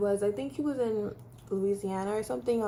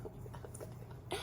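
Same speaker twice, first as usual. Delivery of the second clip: whispered, much softer; scared child in the ambulance, whispering; frightened whisper; 18 dB quieter, much faster than usual. Retold or imitated. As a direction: barely breathe the words in fear.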